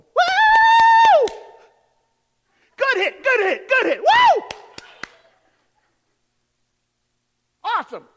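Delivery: full-throated stadium yells, way up high; ballpark cheering. Retold or imitated. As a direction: cheer your grandkid's hit from the stands.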